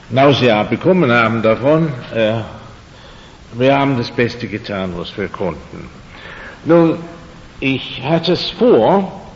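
usual speaking voice

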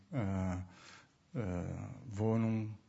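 ordinary delivery

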